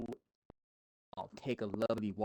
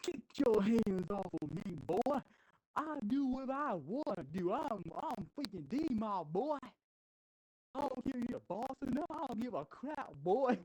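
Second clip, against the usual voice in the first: much higher, cocky put-on voice; defiant tough guy voice; 10 semitones higher than usual. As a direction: sneer it back like a brat who won't listen. D-Mob's defiant retort in the quarrel.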